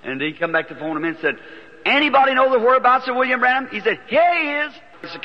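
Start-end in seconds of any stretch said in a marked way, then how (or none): 1.9-3.7 raised, quick platform announcement; calling out. Retold or imitated. Man at the microphone seeking a preacher, calling out. 4.1-4.7 high-pitched retold call. Man beside the preacher pointing him out, calling out.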